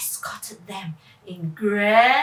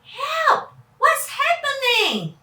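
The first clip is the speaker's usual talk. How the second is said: much higher, louder cry for help; panicked cry; 12 semitones higher, 5 dB louder, slower than usual. Acted. Children panic as the helicopter spins, crying out. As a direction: yelp in panic.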